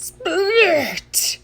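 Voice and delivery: high, drawn-out "splurt"; comic splatting smooch; wet kiss sound effect. A narrator's reading. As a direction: make a sloppy, spluttering kiss noise.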